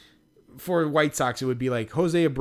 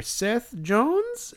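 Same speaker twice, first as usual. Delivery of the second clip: put-on German accent; 10 semitones higher than usual. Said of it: "like a German accent".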